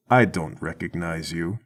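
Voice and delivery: a hint of a quiet heavy; lightly voiced Mac. Mac walks up to a stranger, lightly voiced low and quiet.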